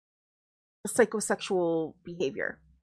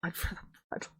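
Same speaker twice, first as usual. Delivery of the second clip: whispered cult chant, soft and eerie; creepy whisper; 4 semitones lower, 12 dB quieter, faster than usual. Acted. Reviewer mimicking the book's blood cult, eerie whisper. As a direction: breathe it like a cultist's secret vow.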